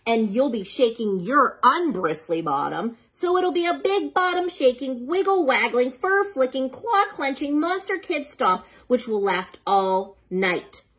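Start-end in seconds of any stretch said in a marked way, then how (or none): none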